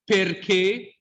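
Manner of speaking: "perché" with both Es closed. Shown as a demonstration. Teacher giving an example, both Es closed in "perché". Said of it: "both closed".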